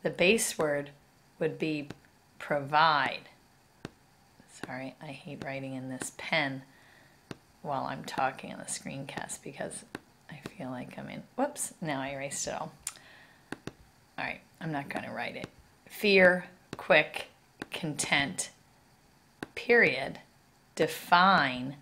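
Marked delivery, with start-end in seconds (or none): 4.7-15.5 quiet aside. Real, not acted. Teacher apologizing while writing on the screencast, quiet aside.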